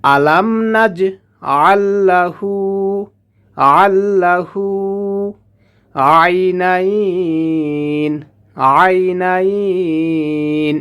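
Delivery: আইনাইন held for three alif lengths; long madd. Shown as a demonstration. Teacher showing the three-alif stretch in আইনাইন. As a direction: drag out the ই in আইনাইন. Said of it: "এখানে তিন আলিফ টেনে পড়তে হবে".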